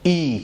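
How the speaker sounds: "ای" alone as pure long ee; correct pronunciation. Shown as a demonstration. Teacher modelling E as in Hindi, correct pronunciation. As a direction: model "ای" with no y before the vowel. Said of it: "اس کا pronunciation بالکل یہ".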